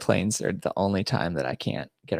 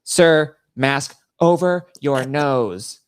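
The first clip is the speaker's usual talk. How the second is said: mock TSA agent, loud and high, each word drawn out; imitated stern order; 7 semitones higher, 9 dB louder, much slower than usual. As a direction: spell it out slowly, imitating an exasperated official. Retold TSA officer repeating order.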